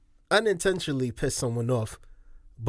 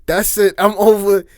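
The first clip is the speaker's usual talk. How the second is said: louder, higher, fed-up outburst; mock fed-up shout; 5 semitones higher, 8 dB louder than usual. Retelling Taxstone ending the interview, mock fed-up shout. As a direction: throw him out, half laughing. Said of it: "Taxstone is like".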